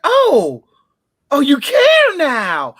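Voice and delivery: high, loud, drawn-out sarcastic outrage; mock indignation. Woman confronting a man who suddenly cares, loudly indignant. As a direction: stretch it out as a scandalized comeback.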